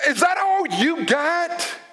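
playful dare; slow mock challenge. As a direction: taunt slowly. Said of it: "you hear someone else say".

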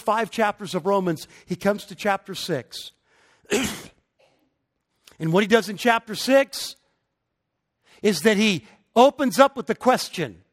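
rising, emphatic sermon delivery; preaching. Acted. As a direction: preach it, building with conviction. Preacher builds to full force.

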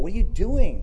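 loud, high rebuke; scolding shout. Retold or imitated. Crowd rebukes the beggar for shouting, scolding loudly. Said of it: "shouting out like that".

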